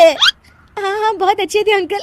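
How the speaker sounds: high condolence breaking into giggles; laughing agreement. Acted. Daughter-in-law praises widow's late husband, laughing helplessly. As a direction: agree while failing to hold laughter. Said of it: "हंस मत".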